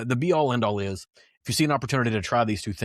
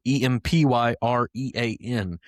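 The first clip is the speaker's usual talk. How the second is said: usual speaking voice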